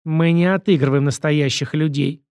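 ordinary delivery, nothing marked

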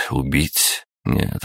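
plain reading voice

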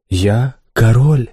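slow, distant otherworldly voice; lightly voiced spirit from afar; ghostly voice. A narrator's reading. Голос духа. (потусторонний голос)

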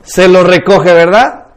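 ordinary delivery

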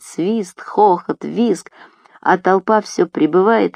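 ordinary delivery, nothing marked

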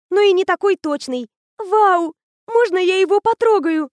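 put-on high, bright-eyed girl raving about a machine; eager teenage girl. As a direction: gush like a know-it-all teen. Anya voiced high, eager.

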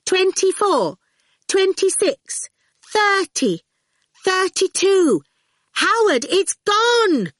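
put-on high, loud child calling numbers. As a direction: sing out each number to the street. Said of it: "calling out each gate number".

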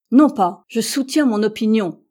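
loud, stubborn insistence; stubborn American refusing to yield, loud. Acted. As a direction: dig in your heels. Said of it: "reprit l'entêté".

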